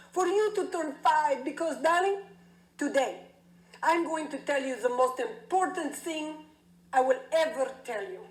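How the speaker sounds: a doting dad unveiling a family legend, swelling to rapture; proud Greek father. Retold or imitated. Father to five-year-old daughter in the den, proud accented dad. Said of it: "And he called to me".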